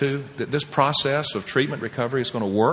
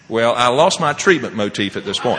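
ordinary talk, nothing marked

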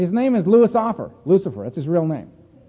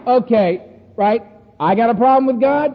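cocky retort; 4 semitones higher, 5 dB louder than usual. Retold or imitated. Retelling his cocky newcomer reaction.

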